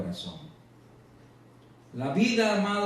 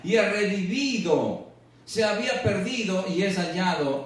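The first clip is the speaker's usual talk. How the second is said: high, slow, declaimed Bible verse; scripture reading; 6 semitones higher than usual. Acted. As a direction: proclaim the verse slowly with raised pitch. Reads scripture aloud to congregation. (lectura bíblica proclamada, lenta y aguda)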